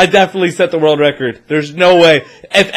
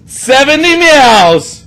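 triumphant; 7 semitones higher, 4 dB louder, much slower than usual. Real, not acted. Revealing winning score.

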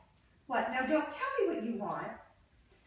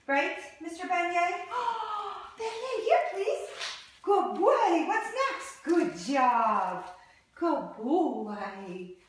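excited puppy praise; 6 semitones higher, 5 dB louder, much slower than usual. Real, not acted. Trainer praising puppy during training.

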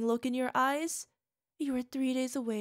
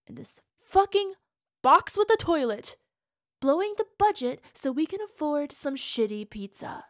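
bitter rant; 3 semitones higher, 7 dB louder than usual. Acted. Partner raging about their tiny apartment, loud bitter rant. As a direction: spit out the disgust at this life.

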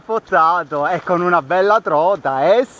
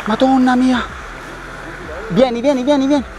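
excited; 6 semitones higher than usual. Real, not acted. Fighting a big fish.

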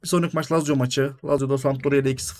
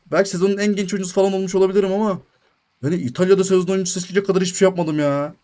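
normal talking voice

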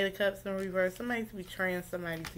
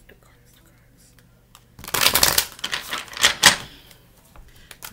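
whispering; 10 dB louder, much slower than usual. Real, not acted. Counting the cards as she draws.

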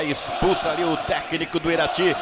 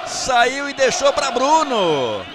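slow, high, roaring goal cry; explosive goal commentary; 4 semitones higher, slower than usual. Real, not acted. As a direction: stretch out the goal scream. Free-kick goal, stretched cry.

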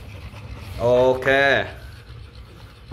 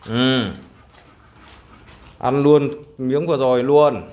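ordinary talk

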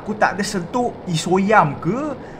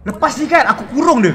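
angry threat; 5 semitones higher, 8 dB louder than usual. Real, not acted. Angry at game character Sweet.

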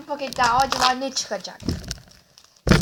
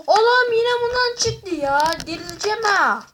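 higher, louder, hammed-up whine of despair; hammed-up exasperation; 6 semitones higher, 4 dB louder than usual. Performing for Esra, hammed-up exasperation at the duplicate. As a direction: play up the frustration for a laugh. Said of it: "Esra yapıyorum".

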